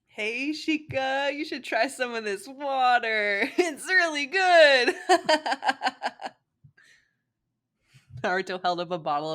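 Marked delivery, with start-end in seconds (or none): 0.1-4.9 put-on high, slow, giggly drunk voice; high laughing drunk Naruto. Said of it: "Naruto stumbled around with his streamer". 5.0-6.3 put-on drunk giggle.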